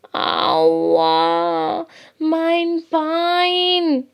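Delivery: long-drawn wail, climbing high; pained cry. A narrator's reading. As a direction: wail like a hurt little hare. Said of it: "schreit Lina".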